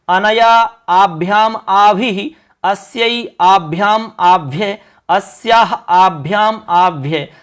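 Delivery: slow, measured chant of the forms; teacher drilling declensions slowly aloud; slow recitation. Shown as a demonstration. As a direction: recite each form slowly for students to repeat. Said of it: "अब बोल के देखो".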